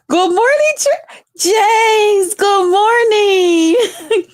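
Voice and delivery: high, whispered, sobbing-laughing hello; a hammed-up tearful morning greeting, slow; mock weepy, high and slow; mock crying greeting.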